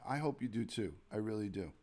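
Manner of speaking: ordinary talk, nothing marked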